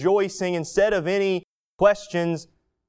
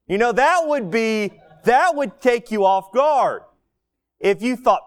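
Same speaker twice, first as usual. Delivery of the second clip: usual speaking voice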